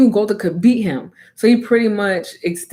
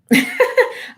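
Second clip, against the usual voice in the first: laughing. Real, not acted. Laughing at own joke.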